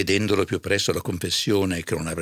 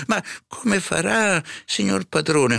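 high, slow, fretting servant; worried housekeeper; 13 semitones higher than usual. A narrator's reading. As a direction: fret over the master in a high voice. Serva in pena per il padrone. (serva in pena, voce acuta)

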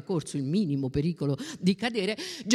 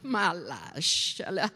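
slow, drawn-out objection; indulgent objection; 3 semitones lower, much slower than usual. A narrator's reading. Bona defends the child playing with pearls, slow and indulgent. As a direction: stretch it out, brushing the worry aside. Bona tells friend to relax.

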